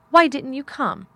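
indignant question with a strong first word; accusing demand. A narrator's reading. Sulky boy demands an explanation.

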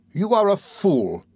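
slow, high-pitched scolding; scornful rebuke. A narrator's reading. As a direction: put him down word by word. Wife scolds her timid husband.

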